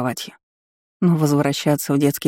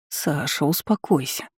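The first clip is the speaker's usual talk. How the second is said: soft, inward voice; soothing self-talk; 6 dB quieter, slower than usual. A narrator's reading. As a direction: quietly talk yourself down. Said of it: "внушала себе". (тихо успокаивая себя)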